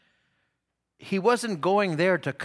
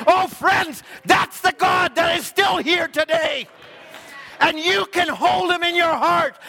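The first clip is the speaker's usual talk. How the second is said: full-force preaching; 13 semitones higher, 7 dB louder than usual. Acted. Preacher rousing his congregation about the living God, loud and high. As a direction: drive each line home to the congregation.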